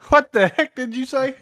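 high-pitched line, exaggerated "hw" starting "What"; pronounced H. Shown as a demonstration. Putting an H in "What" whenever they say that, exaggerated H. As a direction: breathe an H into "What", as "hwat". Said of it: "gotta pronounce the H".

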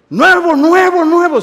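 high, loud preacher's cry; exuberant outburst. Real, not acted. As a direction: burst out at full voice.

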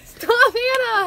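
high, loud laughing shriek, drawn out; laughing protest. Real, not acted. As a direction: cry "stop" through helpless laughter.

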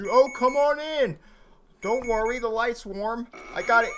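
high, loud calling out; mock welcome call. Player inviting the game's teddy in, mock-welcoming call. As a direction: call it out in a high, drawn-out singsong.

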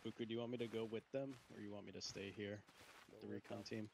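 hushed radio murmur; hushed question. Real, not acted. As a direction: ask it quietly, just to the squad leader. Low private question to squad leader.

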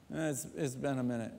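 quick, soft, embarrassed mumble; sheepish son. Retold or imitated. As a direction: rush the admission under your breath. Son answering father.

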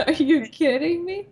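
echoing it back through laughter; laughing echo. Retold or imitated. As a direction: repeat it while laughing. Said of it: "laughs echo".

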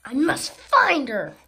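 a big determined shout; loud resolve. Acted. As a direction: declare it with heroic resolve. Character resolves loudly.

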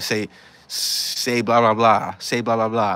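loud, insistent command; retold bullying demand. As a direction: imitate a bully forcing the words out. Retold bully forcing him to speak.

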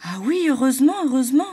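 high, loud relieved cry; relieved exclamation. A narrator's reading. Zigzag exclaims in relief over the flames, high and loud. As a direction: burst out in relief. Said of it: "s'exclama Zigzag".

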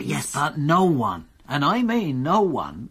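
slow, weighty stress on no one, twice; emphatic no one. Acted. Detective to the don, insisting, slow and stressed. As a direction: lean hard on each no one.

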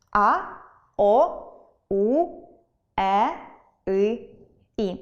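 "а, о, у, е, и, і" as separate vowels; vowel sounds demonstrated. Shown as a demonstration. Teacher listing the six Ukrainian vowels, each sounded separately. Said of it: "шість голосних".

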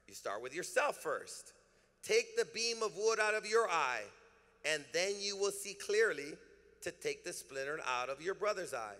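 loud, rising preacher's reading of scripture; preaching scripture. Acted. Pastor reading verse four to the congregation, fervent and loud. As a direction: preach it out, building fire.

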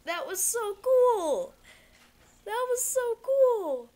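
high, slow, awed; amazed. Real, not acted. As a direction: savor it with awe. Amazed by cutscene.

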